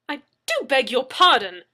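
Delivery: an offended gentleman protesting; indignant exclamation. A narrator's reading. Leslie exclaims at Anna, affronted and loud. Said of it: "I exclaimed".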